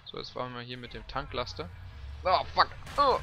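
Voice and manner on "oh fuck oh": loud sudden curse; startled shout. Real, not acted. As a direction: yelp it out in shock. Sudden crash in game.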